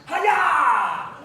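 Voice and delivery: high, loud martial arts shout; karate chop yell. Retold or imitated. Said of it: "Mister Cushioning jumps up and goes".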